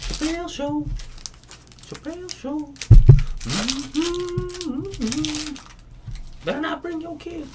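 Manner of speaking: high put-on voice quoting a sketch; high mock Chappelle voice. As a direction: squeak it out like a sketch character. Impersonating Chappelle Show bit.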